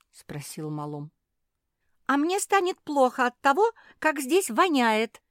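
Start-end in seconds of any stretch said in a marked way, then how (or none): 2.1-5.2 high, loud spoilt whine; petulant. A narrator's reading. Naretta complaining to Malum of the stink, petulant. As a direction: fuss like a capricious child. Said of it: "закапризничала Наретта".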